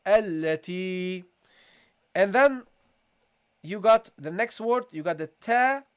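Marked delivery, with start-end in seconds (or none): 0.1-1.2 التي slow, doubled lam, long i; teacher modelling التي; slow recitation. Shown as a demonstration. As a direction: stretch the ya in التي. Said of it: "that's how you pronounce it".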